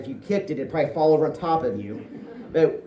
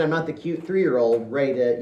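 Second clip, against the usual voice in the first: usual speaking voice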